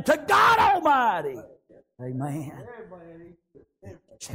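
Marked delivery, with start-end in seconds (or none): 0.0-1.4 high, loud and drawn out; full-force revival cry; preaching shout. Acted. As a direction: lift it up to God Almighty.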